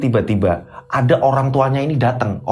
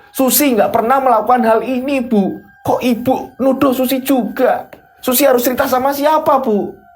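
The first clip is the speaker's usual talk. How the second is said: high-pitched, wounded pleading; desperate protest; 9 semitones higher than usual. Acted. Daughter protesting her mother's accusation, high and desperate. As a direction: plead innocence, voice climbing high.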